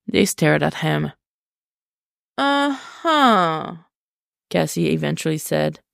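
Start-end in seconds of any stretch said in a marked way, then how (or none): 2.4-3.8 high, drawn-out disbelieving uh-huh; sarcastic uh-huh. Acted. Cassie doubting Tim's story, sarcastic disbelief. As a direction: let him know you don't buy it.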